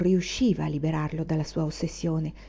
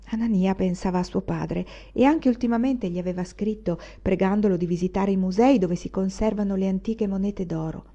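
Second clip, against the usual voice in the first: plain reading voice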